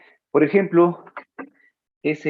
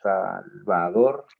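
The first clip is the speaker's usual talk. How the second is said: Salvador said slowly, the final r clear, rising; 2 semitones lower, much slower than usual. Shown as a demonstration. Teacher contrasting Salvado and Salvador, slow. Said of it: "A ver".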